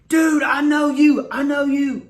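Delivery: loud, high, drawn-out shout; excited recognition. Retold or imitated. Idol recognising a fan in the crowd, excited shout. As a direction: imitate a star thrilled to spot someone.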